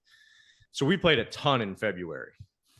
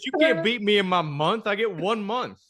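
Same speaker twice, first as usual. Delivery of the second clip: higher-pitched retold protest; mock indignant protest; 8 semitones higher, 3 dB louder than usual. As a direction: protest like a wronged champion. Acts out friend's indignant protest.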